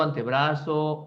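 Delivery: usual speaking voice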